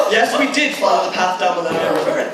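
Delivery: normal talking voice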